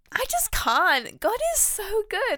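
joyful, near tears, praising God; overwhelmed with joy. Real, not acted. Podcaster overwhelmed by the verse she was given, rising high and joyful. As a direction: voice leaps high, slow and stretched on I just can't. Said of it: "And I can't stop smiling".

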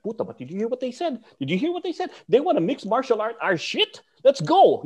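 high, worked-up retold outrage; indignant protest. Student protesting to his teacher about an insult, high and indignant. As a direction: plead to be let loose, pitch climbing.

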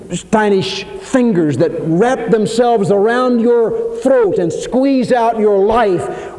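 drawn out, rising as sin strangles; slow menacing build. Acted. Preacher to congregation, sin as a slow choking grip. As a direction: stretch it like creeping fingers at the throat.

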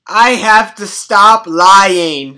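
slow, loud declaration; mock confession. Grandson confessing his lying to grandma, loud mock confession. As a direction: proclaim it loudly, drawing out the words.

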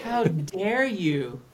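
high, drawn-out mock outrage; mock indignation. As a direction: play scandalized, stretching the words slowly. Mock outrage at being outed.

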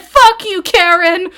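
yelled high and loud; sudden barbarian outburst; mock rage. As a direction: explode at Karen in a fake rage. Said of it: "I'd be like".

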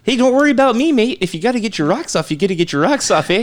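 reassuring Canadian caricature; mock Canadian accent. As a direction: shrug it off in a joke Canadian accent. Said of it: "they always go".

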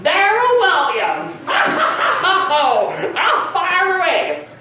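high, stretched-out mock proclamation; hammed-up name correction. Comedian mocking proud name correction, high and theatrical. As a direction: overdo it grandly, dragging the words out high. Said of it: "I don't go".

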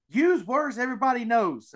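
high-pitched mock scolding; mock complaint. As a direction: imitate an indignant emailer, pitched well up. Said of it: "I'll get emails that are like".

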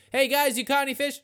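high mock hail; calling out. Acted call to the fishermen.

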